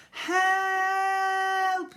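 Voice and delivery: high, loud desperate cry; cry for help. A narrator's reading. Ned tumbling through the air, crying loudly for help. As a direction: call out for help while falling. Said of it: "he cried".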